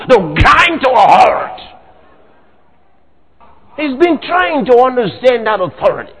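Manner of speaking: ordinary talk, nothing marked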